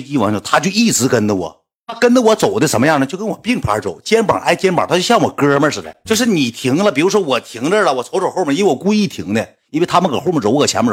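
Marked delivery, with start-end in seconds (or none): none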